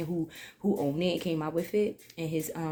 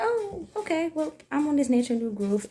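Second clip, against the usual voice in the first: high, bright-eyed surprise at the find; surprised oh; 10 semitones higher, 4 dB louder than usual. Retold or imitated. To herself, finding the cream in the bag: high surprised okay. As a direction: jump up high on the oh, pleasantly surprised.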